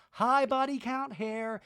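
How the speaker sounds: high, slow mimicked voiceover; put-on high sing-out greeting; exaggerated reality-show impersonation; Angie. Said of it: "you hear Angie's voice in a voice".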